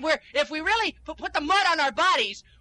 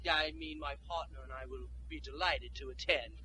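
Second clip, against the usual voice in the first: suddenly prim and refined, much softer and lower; posh cover-up; 14 semitones lower, 15 dB quieter than usual. Acted. Caught swearing, he covers with a refined voice. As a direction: switch into a stiff polite society voice.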